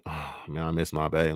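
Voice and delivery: soft, sighing "oh man, I miss my babe"; wistful longing. Retold or imitated. Partner away, sighing softly with longing. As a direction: let the missing show, quietly. Missing partner after time apart.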